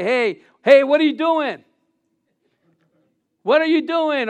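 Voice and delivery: high, loud "what are you doing?"; startling challenge. Acted. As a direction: catch someone in the act. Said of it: "He doesn't say".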